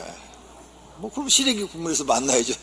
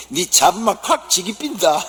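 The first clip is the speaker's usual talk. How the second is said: higher, louder dialect threat, laughing; gruff Gyeongsang husband; 7 semitones higher, 7 dB louder than usual. Acted. Gyeongsang husband threatening his wife, loud dialect. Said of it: "경상도 남편이".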